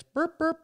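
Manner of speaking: two very high mock burp sounds; imitated burps. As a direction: make the drunk burp noises.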